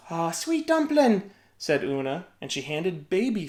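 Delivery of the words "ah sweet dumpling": slow, higher tender coo; cooing mother. A narrator's reading. Una soothing baby Finn.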